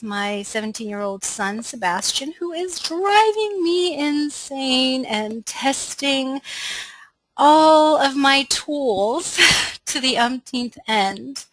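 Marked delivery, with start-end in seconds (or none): 2.3-11.4 higher, drawn-out mock complaint; slow, emphatic comic despair; playful exasperation. Real, not acted. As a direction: stretch out each word in amused exasperation, louder, laugh breaking in. Joking complaint about teenage son.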